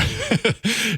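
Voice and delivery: short, high chuckle; light laugh. Real, not acted. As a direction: let out a quick little laugh. Host laughs after thanking sponsor.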